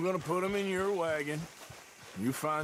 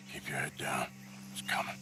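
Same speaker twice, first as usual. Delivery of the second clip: low urgent whisper; 11 semitones lower, 6 dB quieter, faster than usual. Acted. Warns as the cougar comes.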